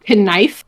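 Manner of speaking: knife said as kuh-NIFE; two-syllable knife with a hard K; sounded silent K. Shown as a demonstration. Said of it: "he refused to acknowledge silent K's".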